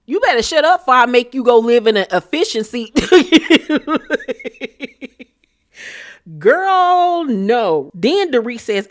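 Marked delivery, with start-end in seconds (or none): 3.0-5.2 loud high burst of laughter; real laugh at her own rant. Real, not acted. Laughing at her own imagined clapback to Dorit. 6.4-7.9 incredulous. Real, not acted. Reaction after laugh.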